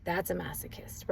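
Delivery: a soft whisper for emphasis; whispered point. Real, not acted. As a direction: drop to a whisper to land the point. Defining masochist in a lecture.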